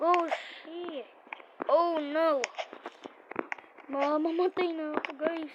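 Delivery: panicked yelps, voice jumping up; screaming while falling. Real, not acted. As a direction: yell in panic as you fall. Character falling in game.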